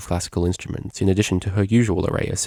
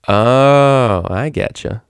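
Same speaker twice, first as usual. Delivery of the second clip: knowing grin; 5 semitones higher, 6 dB louder, much slower than usual. Acted. Vinyl grins knowingly.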